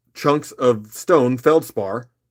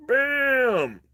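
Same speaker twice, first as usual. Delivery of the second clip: excited exclamation; 12 semitones higher than usual. Real, not acted. Showing off red hots.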